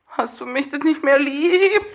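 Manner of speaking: high, slow pleading; mock needy voice. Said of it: "kommt irgendwann per WhatsApp".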